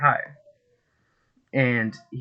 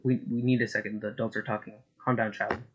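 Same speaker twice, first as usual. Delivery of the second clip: hushing condescension; 7 dB quieter than usual. Acted. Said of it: "looks at him and says".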